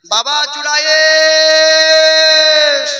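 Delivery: long drawn-out high shout at the door; a mother's loud drawn-out call. Acted. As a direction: call him from far away, stretching the name slowly. Mother calls son at door.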